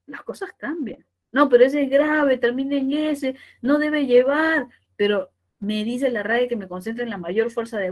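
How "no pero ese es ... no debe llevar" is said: louder, higher imagined student protest; mock objection. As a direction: object insistently, as a doubting pupil.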